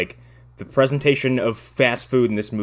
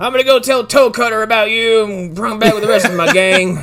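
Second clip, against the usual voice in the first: whiny bully; 11 semitones higher, 6 dB louder than usual. Retold or imitated. Mocking bully threat.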